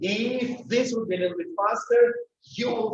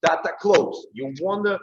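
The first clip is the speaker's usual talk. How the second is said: ordinary talk, nothing marked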